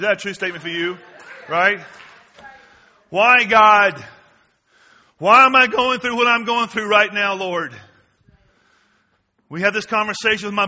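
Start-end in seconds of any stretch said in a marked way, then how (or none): 3.1-7.7 a hint of anguished prayer, louder; pleading cry to God. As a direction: cry out your why to heaven. Voicing a believer's cry to God.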